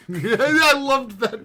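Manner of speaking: high, loud laughing delight. Real, not acted. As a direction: laugh through the words.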